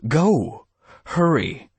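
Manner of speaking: high, loud, urgent; urgent yell. Acted. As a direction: shout at him to get moving. Urging driver after yelling.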